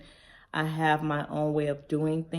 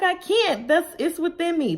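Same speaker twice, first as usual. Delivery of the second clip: much higher, louder helpless cry; exasperated protest; 13 semitones higher, 6 dB louder than usual. Retold or imitated. Answering her husband about acting like mom, high exasperated protest. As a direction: plead that she just can't help it. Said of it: "I'm like".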